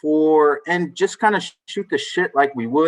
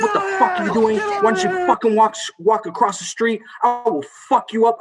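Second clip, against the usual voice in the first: high, strained imitation of a cussing man; ranting stranger; 8 semitones higher than usual. Homeless man cussing out a passing dad, imitated angry rant. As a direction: mimic his outraged tirade in a raised pitch. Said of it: "this guy stands up and immediately starts fucking cutting".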